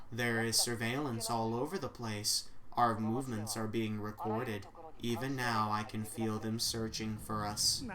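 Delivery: low, soft, even assistant voice; AI secretary voice. Acted.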